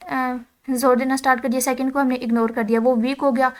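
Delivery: usual speaking voice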